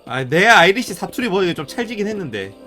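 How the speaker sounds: usual speaking voice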